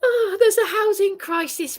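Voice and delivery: pleading about the housing crisis, in tears; crying protest. Real, not acted. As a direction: sob it out.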